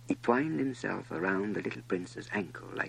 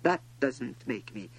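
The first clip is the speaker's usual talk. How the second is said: lightly voiced prince, higher pitched; higher, understated lament of a young prince; disillusioned little prince; 8 semitones higher than usual. A narrator's reading. Prince reflects on his common rose, mildly disillusioned.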